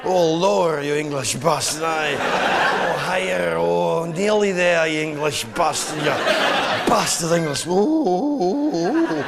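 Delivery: pleasured Scotsman. Acted. Scotsman getting a warm chamois rub while cursing, pleasured moaning. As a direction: moan the insults as if being pleasurably rubbed. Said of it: "sounds like he's having his genitals rubbed".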